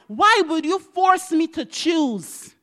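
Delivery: slow, high, loud plea; retold protest. Mother questions God over choosing her kids, protesting. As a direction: draw out a pained challenge to God.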